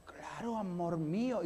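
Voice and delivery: soft, low, loving answer; tender reply. Pretend. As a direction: murmur it sweetly to a spouse.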